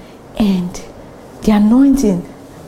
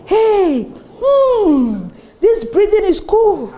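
mock amazed relief; 8 semitones higher, much slower than usual. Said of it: "you realize".

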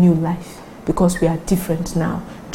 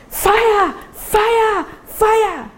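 high alarmed cries of fire; shouting fire; 12 semitones higher, much slower than usual. Retold or imitated. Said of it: "someone starts shouting".

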